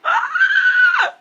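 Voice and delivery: long high yell of frustration; frustrated scream. Real, not acted. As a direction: let out a shrill shriek. Frustrated outburst.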